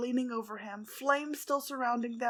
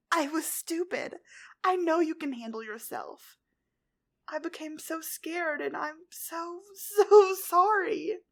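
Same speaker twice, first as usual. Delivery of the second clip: tearful confession; 6 semitones higher, 4 dB louder than usual. A narrator's reading. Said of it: "started to cry once more".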